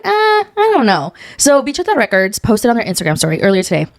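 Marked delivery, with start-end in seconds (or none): 0.1-1.1 doubtful. Retold or imitated. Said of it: "now I'm like".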